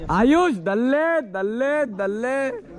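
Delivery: calling out to hear the echo; cave echo. Pretend. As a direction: shout and let it ring back. Shouting into echo.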